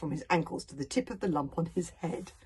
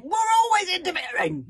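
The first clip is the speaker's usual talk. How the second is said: high, loud, furious yell; angry yell; 14 semitones higher, 11 dB louder than usual. A narrator's reading. Fishlegs yells at Hiccup, high and furious. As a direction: yell it back in a rage. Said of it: "yelled Fishlegs".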